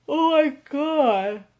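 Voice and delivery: slow words stretched through a yawn. Real, not acted. To her viewers about Rick and Morty, slow, through a yawn. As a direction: say it mid-yawn, slow and drawn out. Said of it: "I swear it's contagious".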